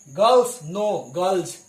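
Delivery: put-on correcting girls to z. Shown as a demonstration. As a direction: stress the final z in गर्ल्स. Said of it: "उसे जो पढ़ना है".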